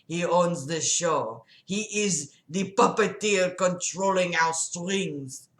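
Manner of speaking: slow, thick French-Russian guy voice; muddled French-Russian accent. Acted. Patient lecturing his doctor about God, slow French-Russian accent. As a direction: stretch words in a loose French-Russian accent. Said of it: "I need another guy voice".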